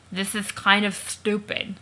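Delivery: slow, mushy words through prop teeth; mumbling the line with teeth stuck in the mouth; mouth full of teeth. Acted. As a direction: talk around a mouthful of fake teeth. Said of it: "he's trying to talk with the teeth in his mouth".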